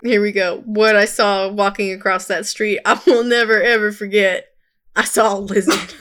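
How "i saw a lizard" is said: laughing through the line; words breaking into giggles; stifled giggles. Shown as a demonstration. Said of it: "It gets me every time".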